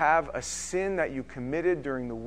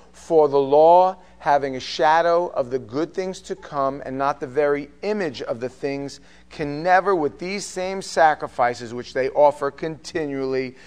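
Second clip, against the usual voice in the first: slow, weighty reading of the verse; scripture reading; 5 dB louder than usual. Acted. Reads scripture aloud.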